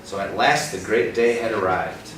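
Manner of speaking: lively picture-book narration; storybook reading. Acted. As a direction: read the race like an exciting story.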